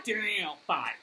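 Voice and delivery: usual speaking voice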